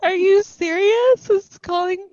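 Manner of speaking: high, loud disbelief; incredulous. Real, not acted. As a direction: challenge it in amused shock. Reacting to claimed term of endearment.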